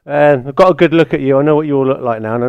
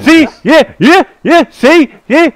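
high, louder taunting sing-song; mocking gloat; 10 semitones higher, 5 dB louder, slower than usual. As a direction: crow over him in a high mocking voice. Gloating over beaten opponent.

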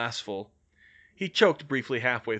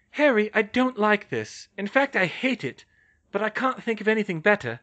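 high-pitched, louder worried objection; reluctant Hermione; 9 semitones higher, 5 dB louder than usual. Acted.

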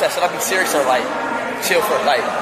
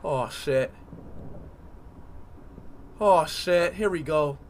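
very low, soft murmur; apprehensive murmur; 11 semitones lower, 14 dB quieter than usual. Real, not acted. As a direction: brace quietly for what is coming. Bracing for game danger.

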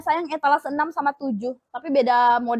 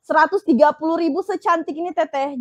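excited gush; 3 semitones higher, 7 dB louder than usual. Real, not acted. Seller gushes over the latte colour.

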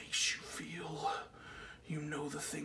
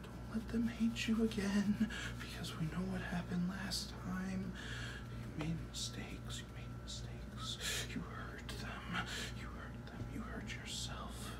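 whispered reproach; 3 semitones higher, 7 dB quieter than usual. Acted. Man reminds himself of past mistakes, hushed whisper. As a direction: whisper the blame to yourself.

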